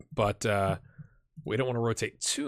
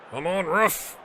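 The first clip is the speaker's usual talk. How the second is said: yelling from the sidelines; 6 semitones higher, slower than usual. Real, not acted. Begging the referee to whistle.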